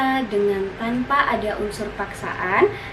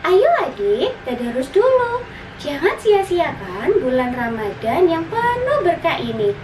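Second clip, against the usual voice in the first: high, louder, eager invitation; urging big sister; 5 semitones higher, 4 dB louder than usual. Acted. Sister invites her brother to recite together, high urging voice. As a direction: coax little brother along brightly and loudly. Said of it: "mengajaknya untuk bertadarus".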